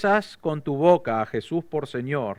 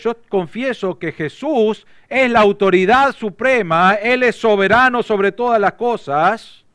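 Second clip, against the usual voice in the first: fervent confession; 7 semitones higher, 6 dB louder than usual. Acted. Preacher voicing the believer's confession, fervent and raised. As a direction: proclaim it like your own vow.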